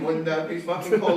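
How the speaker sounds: normal talking voice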